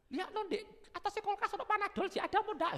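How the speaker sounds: put-on high, quick call to the child; mother calling. Mother asking her child about Panadol, high and quick. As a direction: ask the kid to check the fridge.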